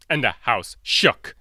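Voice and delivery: usual speaking voice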